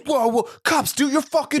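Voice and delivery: very high mock shriek for help; panicked yell. Craig yells to cops as Austin swings, panicked yell. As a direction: imitate a man squealing orders at police.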